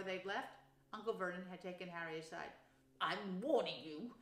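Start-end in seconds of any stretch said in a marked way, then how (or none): 3.0-4.1 slow, measured threat; menacing warning. A narrator's reading. Uncle cornering his nephew, slow and menacing. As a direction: warn slowly and heavily as a stern uncle.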